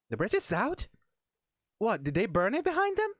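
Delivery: put-on high, incredulous Valley Girl. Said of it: "the Valley Girl voice".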